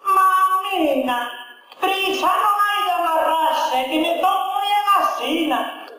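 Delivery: daughter's voice in a comic verse recital; daughter answering mother. Acted. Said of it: "pero la filla dice".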